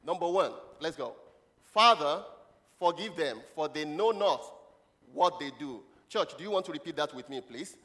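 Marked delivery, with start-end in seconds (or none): 1.6-5.8 loud ringing call on the first word, then measured phrases; scripture proclamation. Acted. Preacher leads the church in Jesus' words, proclaimed. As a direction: declaim it loudly, phrase by phrase. Models scripture for congregation to repeat.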